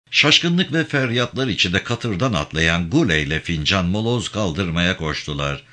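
ordinary talk

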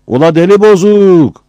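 high, loud jeer; shouted taunt. Acted. Çaput Ömer keeps taunting Battal, high and loud. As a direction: yell it again across the yard. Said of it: "buraya bağırıyordu habire".

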